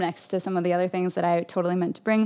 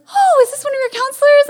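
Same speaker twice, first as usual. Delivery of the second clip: cheerful parents; 7 dB louder than usual. Retold or imitated. Parents greet counselor.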